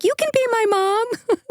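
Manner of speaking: high, squealed fan imitation; mock-adoring squeal; gushing fan voice. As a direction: gush it like an adoring listener, way up high. Acting out gushing listener comments.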